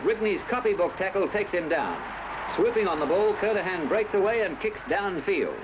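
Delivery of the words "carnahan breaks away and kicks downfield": usual speaking voice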